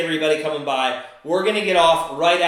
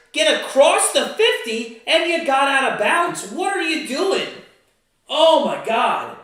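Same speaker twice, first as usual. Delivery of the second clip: angry rant; 8 semitones higher, 3 dB louder than usual. Real, not acted. Ranting at defender's mistake.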